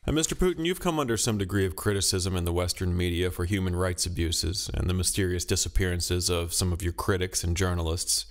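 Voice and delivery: normal talking voice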